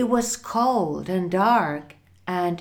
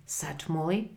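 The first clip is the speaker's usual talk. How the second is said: usual speaking voice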